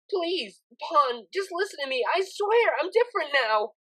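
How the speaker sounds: tearful mare begging a friend; tearful pleading. Acted. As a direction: plead desperately on the phone.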